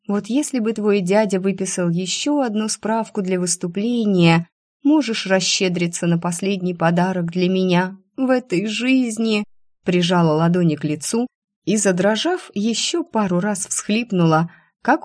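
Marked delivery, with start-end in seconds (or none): none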